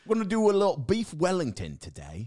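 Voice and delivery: a hint of a British chef voice; bad British accent. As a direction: present the dish like a TV chef. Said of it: "you're like half Southern".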